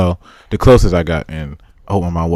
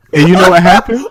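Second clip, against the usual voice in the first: excited; 9 semitones higher, 4 dB louder than usual. Real, not acted. Building the story's climax.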